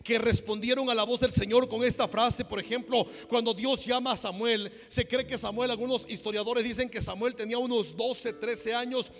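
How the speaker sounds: loud fervent sermon voice; full-force preaching. Acted. Pastor preaching loudly to his congregation. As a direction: preach it with all your fire.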